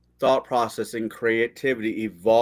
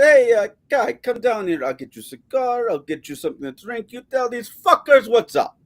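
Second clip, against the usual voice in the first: excited invite; 11 semitones higher, 4 dB louder than usual. Retold or imitated. Retold invite to friend.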